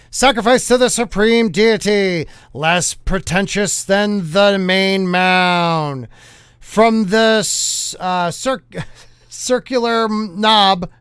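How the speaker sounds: slow, drawn-out newsreel announcer; hammed-up vintage bulletin read; 1950s news voice. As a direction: stretch the phrases like an old-time newscaster. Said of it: "read it in a nineteen fifties news style voice".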